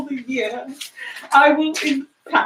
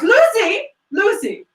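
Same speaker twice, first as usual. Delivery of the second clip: calling out; 7 semitones higher than usual. Acted. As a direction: summon the maid. Calling maid.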